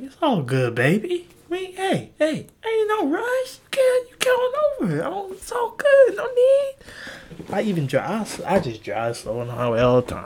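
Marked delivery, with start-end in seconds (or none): 0.0-6.7 laid-back driver. Retold or imitated. To drivers who cut him off, high mellow crooning. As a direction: coo it like a blissed-out hippie. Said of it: "'Cause I'm like".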